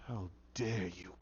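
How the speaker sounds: very low, soft and slow; indignant words dropped almost to nothing; hushed indignation. Retold or imitated. Woman confronting her boyfriend's killer, hushed indignation.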